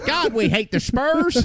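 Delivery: put-on Texan accent. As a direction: grumble like a cartoon Texan fan. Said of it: "And you go to Texas?".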